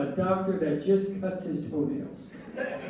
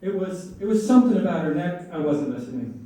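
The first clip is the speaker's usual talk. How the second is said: ordinary talk, nothing marked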